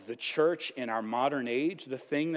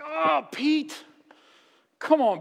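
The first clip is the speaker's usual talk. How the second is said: exasperated groan; 14 semitones higher, 7 dB louder, slower than usual. Pretend. Exasperated at Peter's blunder.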